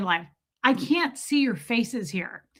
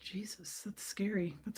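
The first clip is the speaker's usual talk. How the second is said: breathless shocked whisper; 2 semitones lower, 16 dB quieter than usual. Real, not acted. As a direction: whisper it, unsettled. Reacting to scary text.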